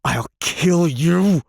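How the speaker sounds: high, slow, near-scream threat; man screams a death threat; crying out. A narrator's reading. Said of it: "he cried".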